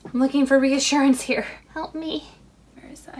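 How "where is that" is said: whispered search to herself. Real, not acted. Crafter hunting for a lost piece, whispering. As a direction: breathe it, no voice at all. Searching while hands full.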